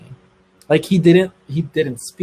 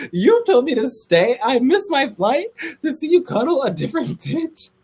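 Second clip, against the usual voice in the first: incredulous; 11 semitones higher than usual. Retold or imitated. To Brady after missing her flight, laughing outrage. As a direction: laugh at how absurd it is.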